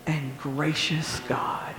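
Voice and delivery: slow soft whisper; slow awed whisper. Real, not acted. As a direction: let it fade into a whisper. Whispered ending of list.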